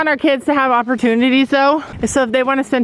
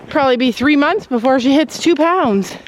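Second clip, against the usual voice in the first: ordinary delivery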